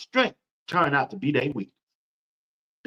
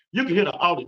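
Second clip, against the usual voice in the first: normal talking voice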